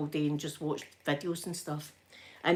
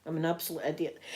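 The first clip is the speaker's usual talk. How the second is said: muttering to herself; 4 semitones lower, 7 dB quieter, faster than usual. Real, not acted. Sighing at her own mistake.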